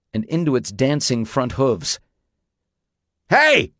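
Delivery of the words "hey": high, loud sudden call; yelled. A narrator's reading. J W yells out at danger, sudden shout. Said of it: "he yelled at the window".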